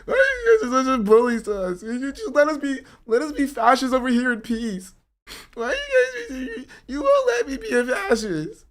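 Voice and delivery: very high, loud fake-crying whine; mock crybaby whine. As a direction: blubber it like a pleading brat. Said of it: "It's like".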